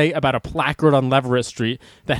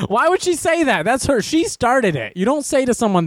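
high-pitched comic protest; squeaky-high, exasperated self-defense; mock indignation; 9 semitones higher than usual. Real, not acted. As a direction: argue your innocence, pitched way up. Mock protest defending his cucumber reply.

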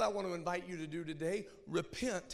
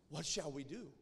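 low, much softer plea; quiet echo; 5 semitones lower, 7 dB quieter than usual. Retold or imitated. Preacher repeating the crowd's question to the congregation, hushed. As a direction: let it sink in, hushed.

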